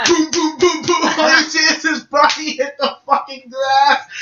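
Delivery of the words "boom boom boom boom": high rapid-fire blast noises; playful video-game explosions; imitated blast sounds. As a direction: sound out a barrage of energy shots.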